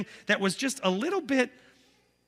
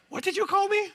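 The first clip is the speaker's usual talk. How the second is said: high, sharp indignant mother's voice; offended mother; 14 semitones higher than usual. Retold or imitated. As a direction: play the shocked mom who heard that. Mother offended by being called woman.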